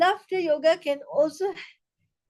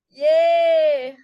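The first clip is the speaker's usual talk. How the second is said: cheer; 2 semitones higher, 5 dB louder than usual. Shown as a demonstration. Cheers to end laughter exercise.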